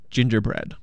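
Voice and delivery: plain reading voice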